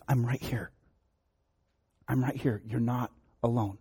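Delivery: a hint of a mother's gentle voice; calm the scared child from the dark; soft reassuring mom. Mother reassures her scared five-year-old, gently.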